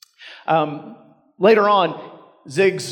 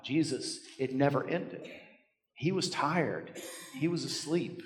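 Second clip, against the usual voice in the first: hushed and weary; 12 dB quieter than usual. Acted. Preacher softly telling how worn out Jesus was, hushed. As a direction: drop to a soft, tired hush.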